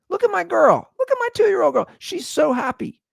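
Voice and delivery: high-pitched, insistent gushing; mock pleading. Dad showing toddler to sullen worker, high mock pleading. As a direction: push the voice right up, showing off your child.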